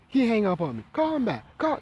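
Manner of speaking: grandma barking at him to call back; shrill grandma order; imitating grandma.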